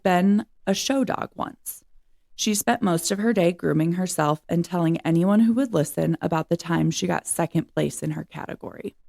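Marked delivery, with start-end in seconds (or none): none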